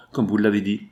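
plain reading voice

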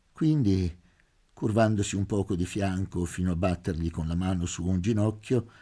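usual speaking voice

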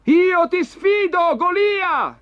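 put-on very high, drawn-out boyish shout; slow, high-pitched defiant cry; childlike little voice. A narrator's reading. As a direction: call out a challenge with all your might. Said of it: "la sua vocetta infantile".

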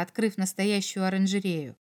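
usual speaking voice

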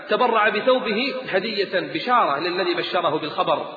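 usual speaking voice

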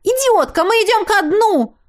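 high, loud frantic yell; panicked shout. A narrator's reading. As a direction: scream in terror. Sinking boat, panic.

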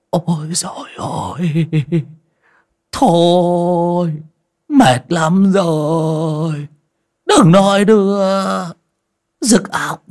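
high, strained moaning, slow and drawn out. A narrator's reading. Sick husband groaning at his wife, high slow moaning. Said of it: "Lão rên rỉ".

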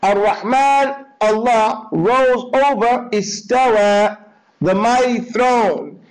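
loud, slow, solemn preaching; emphatic preaching. Acted. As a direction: proclaim it with slow force.

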